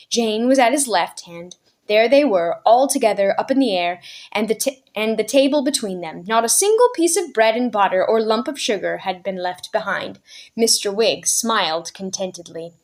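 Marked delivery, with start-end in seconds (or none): none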